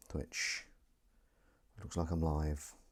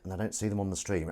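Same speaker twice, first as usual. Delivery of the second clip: ordinary talk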